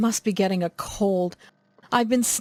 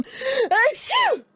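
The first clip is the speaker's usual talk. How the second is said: sneeze. Acted. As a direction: sneeze mid sentence. Acted sneeze.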